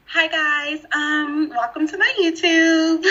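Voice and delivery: affected social media intro voice; put-on YouTuber voice. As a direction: imitate a YouTuber code-switching for the camera. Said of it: "That's not the voice you use on a job interview".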